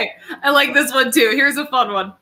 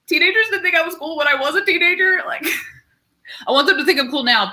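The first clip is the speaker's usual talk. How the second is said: half-laughing, quick, higher whine; whiny laughing complaint; 6 semitones higher, faster than usual. Retold or imitated. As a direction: whine it through a laugh, fast. Whiny retold self.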